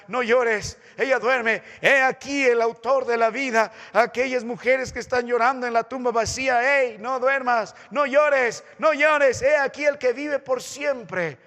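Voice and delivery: loud, high preaching of comfort; fervent proclamation. Acted. Preacher voicing Christ's comfort to mourners, fervently. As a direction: proclaim it triumphantly.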